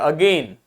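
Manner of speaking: Again with light "uh", stressed long "gain"; stressed second syllable. Shown as a demonstration. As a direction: lean on गेन in again, keep अ light. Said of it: "तो stress दे दिया".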